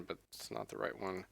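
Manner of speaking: ordinary talk, nothing marked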